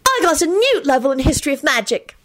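high, excited boast; high-pitched gleeful announcement; screeched. A narrator's reading. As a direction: blurt it out high and triumphant. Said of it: "Hermione screeched and leapt to her feet".

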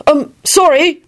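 high-pitched, panicky apology; frantic stammer. A narrator's reading. Neville apologises before the Minister, frantic. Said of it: "Neville stammered out frantically".

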